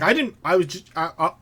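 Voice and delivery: flustered, halting stammer; fumbling for words. Acted. Cowed mercenary excuses himself to the shouter, fumbling and stammering. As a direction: trip over a feeble excuse. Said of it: "fumbles for his words".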